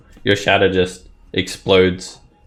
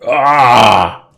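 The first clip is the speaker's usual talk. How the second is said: loud, raised "aah"; acted cry of effort; 4 semitones higher, 8 dB louder than usual. Game master voices combat as arrow strikes, loud cry.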